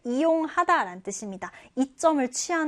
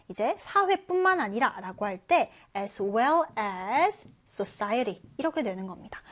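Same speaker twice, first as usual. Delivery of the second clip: normal talking voice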